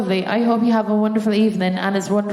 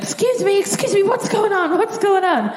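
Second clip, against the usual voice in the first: much higher, alarmed and polite; 12 semitones higher than usual. Retold or imitated. Patient in the chair asking the doctor, high and alarmed. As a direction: squeak it out in a panic. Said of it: "I was like".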